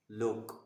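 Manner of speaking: short pesh vowel in look. Shown as a demonstration. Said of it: "وہ پیش کی آواز دے رہا ہے".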